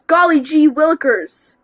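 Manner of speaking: high, loud cartoon voice; test tube voice. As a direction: gush like a wholesome cartoon kid. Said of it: "in a test tube voice".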